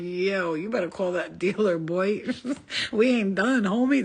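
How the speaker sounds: egging the crew on to call the dealer; hyped party demand. Retold or imitated. Telling friends to call the dealer, loud and hyped. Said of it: "I'd be like".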